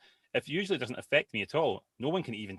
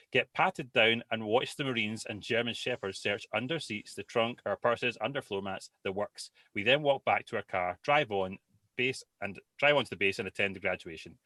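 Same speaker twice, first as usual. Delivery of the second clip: usual speaking voice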